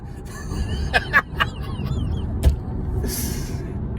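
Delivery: squeaky helpless laughter; high squealing laugh. Real, not acted. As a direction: laugh high and breathless at the memory. Laughing at own story.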